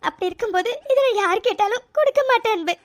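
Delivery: thanking through tears, voice breaking; grateful sobbing; crying. Acted. Thanking her husband for saving the chain, while crying. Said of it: "என்ன இது இப்படி அழுதுட்டு இருக்கா".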